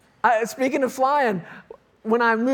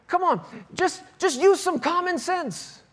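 high-pitched mock-exasperated instructor; imitated frustration; 8 semitones higher than usual. Flight instructor to struggling student, mock frustrated. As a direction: mimic a flight instructor losing patience, pitched up. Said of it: "he's getting frustrated with me".

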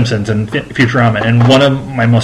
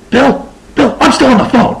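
very high, put-on phone caller voice; high-pitched mock interjection; imitating Nimoy; 14 semitones higher than usual. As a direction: pipe up from the other end of the line. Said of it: "you hear on the other end".

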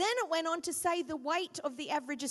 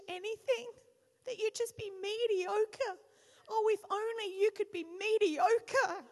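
mock tender blessing; 4 semitones higher than usual. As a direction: coo over the newborn, then hammed-up tears.

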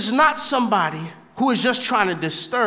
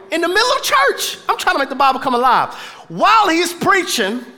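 ordinary delivery

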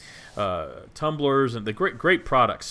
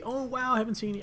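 mock surprise; 10 semitones higher, 6 dB quieter, faster than usual. Imagined reunion greeting.

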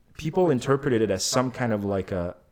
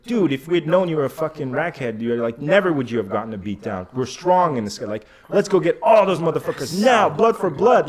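louder, higher hammed-up gang fervour; frothing excitement; 5 semitones higher, 5 dB louder than usual. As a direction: whip the crew up for revenge. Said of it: "frothing at the mouth".

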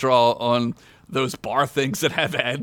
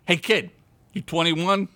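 mildly stern challenge, pitched higher; 6 semitones higher than usual. A narrator's reading. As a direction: question the kid with a touch of sternness. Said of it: "Fred asked sternly".

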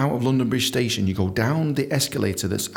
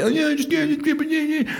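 mock freeloader. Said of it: "they just fucking abuse you".

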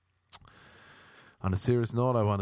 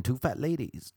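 usual speaking voice

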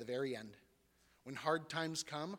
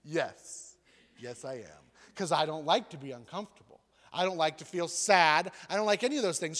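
emphatic protest; 9 dB louder than usual. Retold or imitated. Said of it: "And I'm like".

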